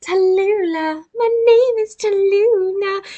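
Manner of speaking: high, slow, drawn-out RP; hammed-up posh British introduction; put-on RP British accent. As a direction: preen in a mock British monkey voice. Mimicking the posh monkey's name.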